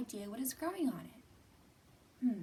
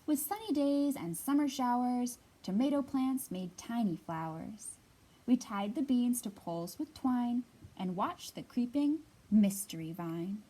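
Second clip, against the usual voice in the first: louder, lively storybook read-aloud; reading to children; 2 semitones higher, 9 dB louder than usual. Acted. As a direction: read it out big and bright for a circle of kids. Reads picture book aloud.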